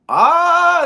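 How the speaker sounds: very high, loud startled ah; shocked gasp. Retold or imitated. Podcaster reliving reading the trade news, shocked. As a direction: jolt of disbelief. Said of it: "I'm like".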